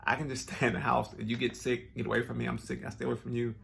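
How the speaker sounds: normal talking voice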